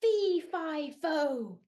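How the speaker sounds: loud booming giant call; giant's shout. Acted. Giant waking up, loud booming shout. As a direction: boom it like a giant. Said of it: "He shouted".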